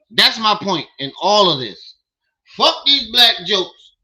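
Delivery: exasperated yelling at the screen; angry rant. Real, not acted. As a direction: hammer the point home, furious. Rant resumes against the collector.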